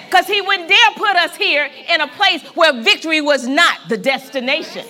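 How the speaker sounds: loud, building sermon; rising preacher's call; preaching. Real, not acted. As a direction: preach it out with full force. Preaching at full force.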